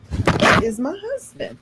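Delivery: high excited claim; giddy certainty. Retold or imitated. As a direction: squeal it like a crush. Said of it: "I said".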